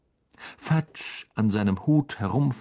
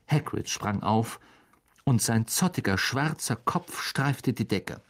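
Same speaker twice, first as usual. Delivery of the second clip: ordinary delivery, nothing marked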